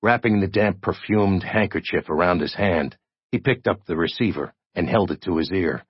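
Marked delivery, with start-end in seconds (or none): none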